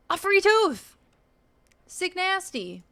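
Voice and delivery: high, loud, thrilled shout; excited. Real, not acted. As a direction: squeal with delight at the find. Delighted at finding free item.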